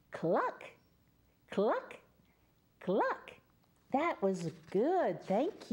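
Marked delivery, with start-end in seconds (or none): 0.1-3.3 short higher clucks, each one rising; chicken clucking. Shown as a demonstration. Storyteller asks children what the chicken says, answers with rising clucks. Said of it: "What does the chicken say?".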